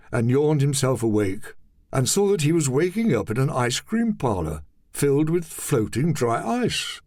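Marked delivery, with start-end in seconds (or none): none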